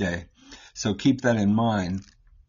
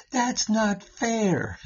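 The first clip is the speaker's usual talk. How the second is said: put-on whiny kid; 10 semitones higher, much slower than usual. Child complaining about a gift.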